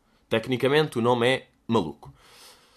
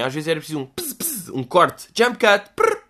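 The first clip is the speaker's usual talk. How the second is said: loud high pss and prrr noises; playful cut sounds; mock edit effects; 4 semitones higher, 3 dB louder than usual.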